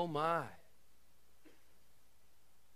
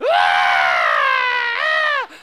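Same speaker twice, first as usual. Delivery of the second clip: huge sustained pretend bawl; hammed-up child crying at full volume; louder mock wail; 18 dB louder than usual. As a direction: wail it out even louder, play-acting. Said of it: "cry louder".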